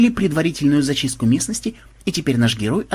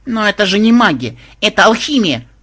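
high, louder aggrieved objection; hurt protest; 7 semitones higher, 5 dB louder than usual. A narrator's reading. Granddaughter objects to the cheating, offended high voice. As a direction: protest like a wronged girl. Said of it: "обиженно заметила внучка".

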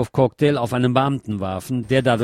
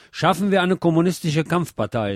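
higher-pitched rallying quote; quoted rally slogan; 6 semitones higher than usual. A narrator's reading. Newsreader quoting a communist speaker at the May Day rally, raised slogan. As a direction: read it as a raised rally call.

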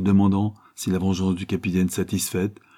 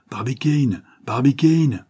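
raised, higher-pitched call of a name; 6 semitones higher, 4 dB louder, slower than usual. A narrator's reading. Ardan walks towards motionless Barbicane, calling out. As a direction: call out to a friend, second call stronger. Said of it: "Ardan fit quelques pas en criant".

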